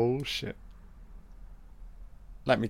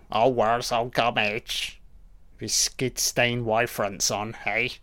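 Wurzel Gummidge voice; 4 dB quieter than usual. Retold or imitated. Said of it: "he's perfectly saying".

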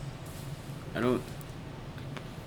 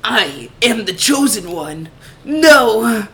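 high, loud, anguished anime hero; anime main character; 9 semitones higher, 5 dB louder than usual. Pretend. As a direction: cry out like a tortured anime lead. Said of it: "my anime main character".

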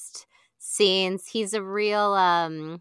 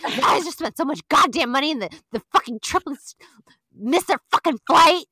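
fast, louder furious rant; angry rant; 6 semitones higher, 4 dB louder than usual. Retold or imitated. Jax ranting to Brittany about the missed flight, angry. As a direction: spit it out in disbelief. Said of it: "And he's like".

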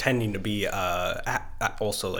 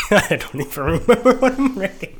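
high, loud, through laughter; cracking up while talking; laughing; 10 semitones higher, 6 dB louder than usual. Real, not acted. Asked to rate it quick, helpless laughing answer.

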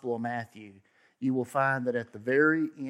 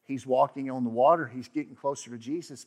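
normal talking voice

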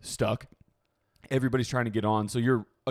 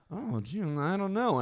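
higher, softer feigned puzzlement; mock cluelessness; 8 semitones higher, 4 dB quieter, slower than usual. Man beside her pretending not to know, mock clueless. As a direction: act baffled, pitched up and quiet. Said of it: "he even does, like, the".

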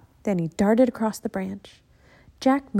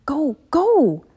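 urgent go; 6 semitones higher, 6 dB louder than usual. Acted. Jack hurrying sister away.

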